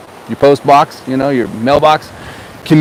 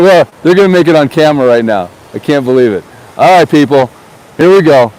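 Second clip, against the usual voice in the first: usual speaking voice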